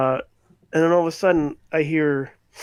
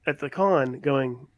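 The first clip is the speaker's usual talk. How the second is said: ordinary talk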